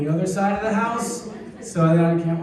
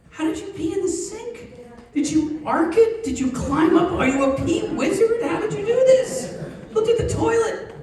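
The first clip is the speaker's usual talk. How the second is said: high, laughing disbelief; incredulous dad; 13 semitones higher than usual. Retold or imitated. Dad questioning his son about the sink, high amazed disbelief. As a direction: squeak it out like you can't believe it.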